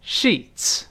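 Sheets ending in a hissing ts; model word. Shown as a demonstration. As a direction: sheets 结尾 ts 发呲的声音. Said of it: "读一下床单的复数". (示范 sheets 尾音 ts)

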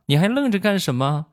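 put-on high child's voice scolding his dad; a small boy snapping orders at grown-ups; bossy little kid. Nephew scolding his stunned dad, high bossy child voice. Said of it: "就冲他爸爸啊".

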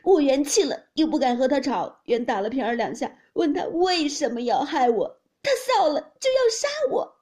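loud, high-pitched wailing account; crying complaint. A narrator's reading. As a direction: pour out your grievance in a loud, tearful wail. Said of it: "凤姐哭道". (高声哭诉)

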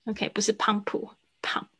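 slow whisper, pump versus pump; whispered pump correction. Shown as a demonstration. Teacher corrects the pump sound in a slow whisper.

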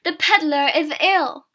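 calling out. A narrator's reading. Child alerting the villagers, calling out. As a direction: shout the news to everyone. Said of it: "I called to the villagers".

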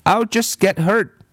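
put-on high, insistent little-girl voice; a stubborn girl contradicting her teacher; bratty child insisting she's right; contrary girl.

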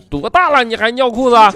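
loud, high mock bellow; angry dad yell. Father scolding son for wet pants, loud mock yell. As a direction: bark it like a furious father.

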